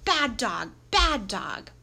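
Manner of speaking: loud, falling reprimand; scolding. A narrator's reading. Master scolding his dog, loud reprimand. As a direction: tell the dog off firmly and loudly.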